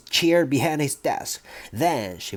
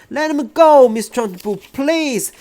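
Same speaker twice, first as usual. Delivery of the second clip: much higher, louder plea; begging; 11 semitones higher, 6 dB louder than usual. Acted. Teacher begs headmistress to release boy, high loud plea. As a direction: beg her desperately. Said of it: "begged Miss Honey".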